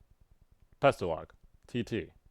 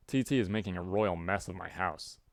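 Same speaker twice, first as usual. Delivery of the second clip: ordinary delivery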